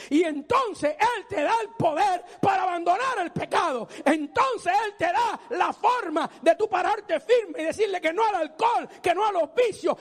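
loud, high-pitched sermon at full force; preacher urging the sinner to turn to Christ, shouting fervently; fiery preaching. Acted. Preacher to congregation about leaving sin, fiery shouting.

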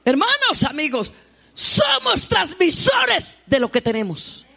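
high, loud, slow pulpit shout; stretched-out preacher's shout; full-force preaching. Real, not acted. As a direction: cry it out to the congregation.

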